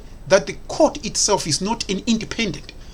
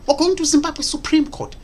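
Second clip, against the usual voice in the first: mocking quote; 9 semitones higher than usual. Mocking repeat of Chamisa's words.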